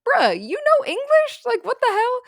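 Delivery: shrieked high and loud in outrage; indignant outburst. Retold or imitated. Liz learns the silent barbarian speaks English, a loud indignant shriek. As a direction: screech it like you've just been tricked. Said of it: "she's, like, all pissed about that".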